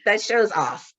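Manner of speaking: ordinary delivery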